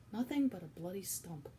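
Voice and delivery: plain reading voice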